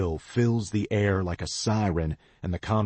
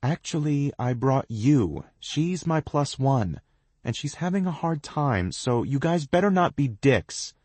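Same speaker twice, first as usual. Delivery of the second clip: firm defending; 9 semitones higher, 3 dB louder than usual. A narrator's reading. Cass defends her friend.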